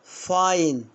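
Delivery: فاين said very slowly with a long "ai"; slow demo of English fine. Shown as a demonstration. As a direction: stretch out فاين with a full "ai" diphthong. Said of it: "أقول فين ولا".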